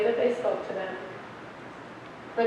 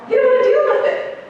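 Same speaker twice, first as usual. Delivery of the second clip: mocking; 13 semitones higher, 6 dB louder, faster than usual. Mocking the principal.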